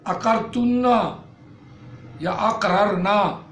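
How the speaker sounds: slow, measured chanting of verb forms; conjugation drill. Shown as a demonstration. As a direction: recite each form like a classroom drill. (گردان آہستہ آہستہ پڑھنا)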